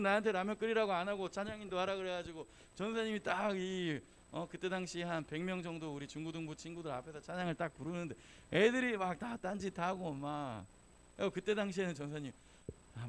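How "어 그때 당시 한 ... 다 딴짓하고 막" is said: normal talking voice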